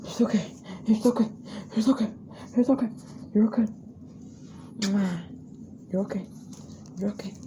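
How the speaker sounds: soothing. Real, not acted. Soothing the scared dog.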